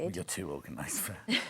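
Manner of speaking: teasing whispered aside. Real, not acted. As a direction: whisper it as a joke. Teasing aside while working.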